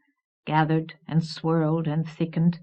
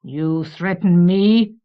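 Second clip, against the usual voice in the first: slow, loud, affronted authority; bristling deep voice; 6 dB louder, slower than usual. A narrator's reading. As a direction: challenge the threat slowly and forcefully. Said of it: "The deep voice bristled".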